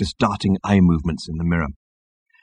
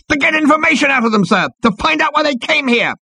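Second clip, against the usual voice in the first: very high, strained protest; exasperated high-pitched underling; frustrated squealing officer. A narrator's reading. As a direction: squeal it at your superior. Frustrated officer answering captain.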